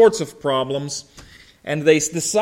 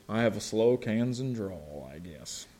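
resigned concession; 8 semitones lower, 13 dB quieter than usual. Retold or imitated. Gives in to reporter's label.

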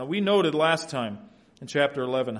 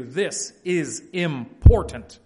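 "This is important" slow, each word punched separately; emphatic; 6 dB louder, much slower than usual. Acted. Repetition telling Peter to take note, "important" weighty and slow. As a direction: space out and hit "This", "is", "important". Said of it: "threefold repetition tells Peter".

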